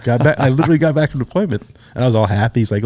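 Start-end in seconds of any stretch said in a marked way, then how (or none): 0.0-1.6 high-pitched, quick excuse; retold eager protest. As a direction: plead your case in a higher voice. Retold protest at being summoned.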